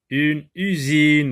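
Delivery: UNE USINE slow and loud, long vowels; mot bien articulé. Shown as a demonstration. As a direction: stretch U and SINE in USINE for a class. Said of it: "le mot usine commence par U". (« une usine » lent et fort, voyelles allongées)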